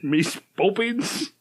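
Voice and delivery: slow fake cockney, rising at the end; hammed-up cockney with a rising tune; bad cockney accent. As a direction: echo it back in a bad cockney. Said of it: "Yours is bad enough".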